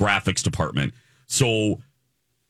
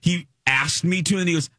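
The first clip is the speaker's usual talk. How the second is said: ordinary talk, nothing marked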